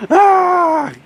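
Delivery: very high, loud held "ah"; badly faked wail after laughing; fake crying wail. Shown as a demonstration. Therapist showing she can't really cry, mock wail. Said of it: "I'm not really crying".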